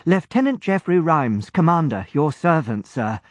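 put-on young lieutenant presenting himself to Lord John, brisk and eager; young naval lieutenant. A narrator's reading. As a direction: boyish lieutenant, keen to please.

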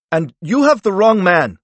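high, loud protest; indignant outburst from an accused man. A narrator's reading. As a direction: insist on it, raising pitch and volume. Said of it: "raising his voice".

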